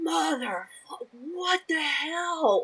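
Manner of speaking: high, slow drawn-out cursing; imitated frustrated swearing. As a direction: drag out the curse in frustration. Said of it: "or the".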